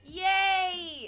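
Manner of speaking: cheer. Real, not acted.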